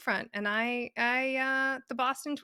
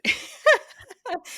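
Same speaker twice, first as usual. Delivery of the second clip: laugh; 11 semitones higher, 5 dB louder than usual. Real, not acted.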